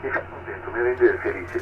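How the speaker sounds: ordinary talk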